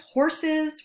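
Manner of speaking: usual speaking voice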